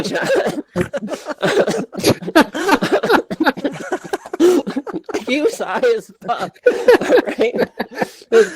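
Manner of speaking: can barely get the words out for laughing. Real, not acted. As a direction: voice breaking into real laughter, climbing high. Cracks up telling John story.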